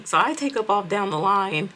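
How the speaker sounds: usual speaking voice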